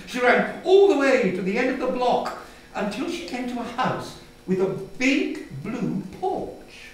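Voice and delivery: usual speaking voice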